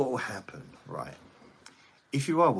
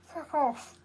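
squeaky high rude dog voice; dog's voice. Pretend. Voicing the dog answering rudely.

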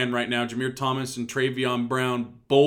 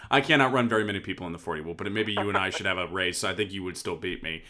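ordinary delivery

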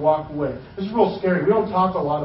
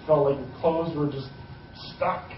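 ordinary delivery

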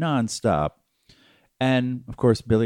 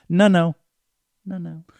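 echo effect; 7 semitones higher than usual. Shown as a demonstration. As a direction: let each no fade like an echo. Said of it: "I go".

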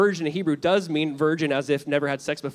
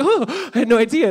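higher, louder mock astonishment, eyes wide; mock surprise; 6 semitones higher, 5 dB louder than usual. Pastor mocking the fake-surprised worker, with hammed-up surprise. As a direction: ham up an "oh really?" gasp. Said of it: "And I was like".

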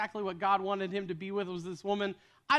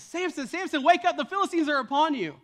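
higher, louder mock shout to wake him; urgent calling out; 7 semitones higher, 5 dB louder than usual. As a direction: call Samson awake as Delilah, rising to a yell. Delilah calling Samson awake.

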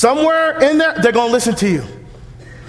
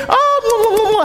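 cooing grandma; 9 semitones higher than usual. Acted.

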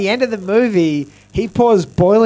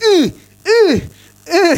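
much higher, loud mock grunts; loud workout grunts; 11 semitones higher, 5 dB louder, slower than usual. Punching-bag guy interrupting the actors' lines, loud high grunts. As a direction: punctuate each punch with an exaggerated grunt. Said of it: "one guy hitting a punching bag".

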